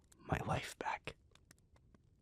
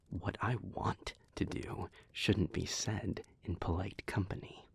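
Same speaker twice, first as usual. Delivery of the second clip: normal talking voice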